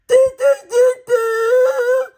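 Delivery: very high, loud hooting mimicry; mocking her voice. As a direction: blast out high nonsense syllables to mock her. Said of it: "Okay, and it shifts from".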